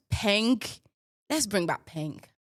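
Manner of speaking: usual speaking voice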